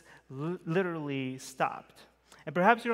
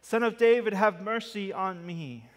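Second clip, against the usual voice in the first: loud desperate cry, louder still; shouting louder; 5 semitones higher, 6 dB louder than usual. Acted. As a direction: shout it out, begging harder. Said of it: "he shouted all the more".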